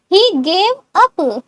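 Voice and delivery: very slow, word by word; slow model. Shown as a demonstration. Teacher first says the answer slowly to students. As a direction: drag it out for learners.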